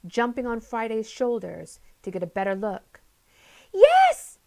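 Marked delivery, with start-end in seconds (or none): 3.7-4.4 a shrieked, very high and loud Yes!; excited cry. A narrator's reading. Polly spots her friend Jake, shrieking with joy. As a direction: burst out in pure delight. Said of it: "she cried it excitedly".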